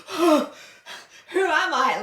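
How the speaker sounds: high, loud mock gasp; gasping surprise. Real, not acted. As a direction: gasp as if caught out. Mock gasp at her own slang.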